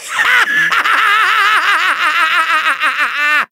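very long, rapid-fire evil cackle; maniacal laughter. Acted. Villain won't attack until he finishes laughing, endless maniacal cackle. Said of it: "just as soon as I finish laughing".

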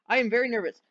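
usual speaking voice

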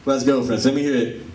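ordinary delivery

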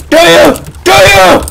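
high, loud repeated shout; mock demanding yell. Asking about the dumpling inside you, mock demanding yell.

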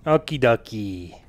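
normal talking voice

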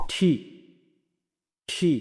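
ঠি with a puffed t; short, isolated aspirated ঠি; correct letter T. Shown as a demonstration. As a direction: burst of air on the t of ঠি. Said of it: "টির সঠিক উচ্চারণ হবে".

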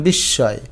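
বিস্ময় as "bish-shoy", no m; silent m. Shown as a demonstration. Teacher giving the pronunciation, বিস্ময় without m. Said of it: "এটার উচ্চারণ".

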